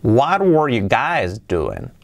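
slow, clearly paced demo of "What were you guys doing"; teacher's drawn-out example; slow model sentence. Shown as a demonstration. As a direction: model it slowly for learners. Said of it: "Fijaos, sería".

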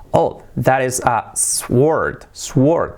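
slow "sword" with the w sounded, like "swuord"; mispronounced sword. Shown as a demonstration. Teacher quoting his former self, mispronounced sword. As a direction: slowly show the wrong "sword" with an audible w. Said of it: "Yo decía".

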